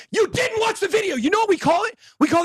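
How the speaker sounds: high, indignant shout; angry outburst. Real, not acted. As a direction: snap it at him, exasperated. Ranting at critic.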